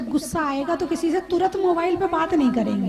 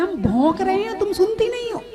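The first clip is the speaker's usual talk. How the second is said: higher mock yell, rising to a squeal; angry shouting spouse; 4 semitones higher than usual. Angry spouse scolds a silent partner, mock yell. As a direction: scold as if barked at. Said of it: "लोग कहते हैं".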